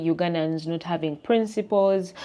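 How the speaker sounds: ordinary delivery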